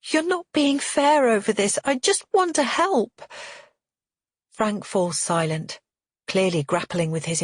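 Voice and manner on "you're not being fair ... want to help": high-pitched pleading near tears; wobbling, tearful. A narrator's reading. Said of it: "Tears prick at my eyes and my voice wobbles".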